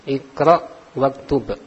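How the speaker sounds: teacher's careful model of iqra waktub for the class; slow model reading. Shown as a demonstration. Teacher modelling the phrase for students, slow and deliberate. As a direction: say iqra waktub slowly and clearly, syllable by syllable. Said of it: "Di situ ada iqra waktub".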